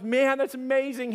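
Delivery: high-pitched delighted reaction; imitating a thrilled friend; amazed.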